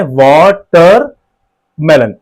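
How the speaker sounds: Watermelon drawn out in separate slow beats; syllable by syllable. Shown as a demonstration. Teacher showing four jaw touches, watermelon said syllable by syllable. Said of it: "तो ये चार बार touch करेगा".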